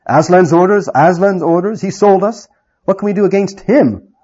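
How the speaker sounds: despairing dwarves. Acted. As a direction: cry out helplessly as a chorus of dwarves.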